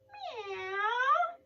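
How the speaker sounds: high, whiny cat meow; be the cat that teases the baby. Acted. Teacher voicing the cat in the story, high meow.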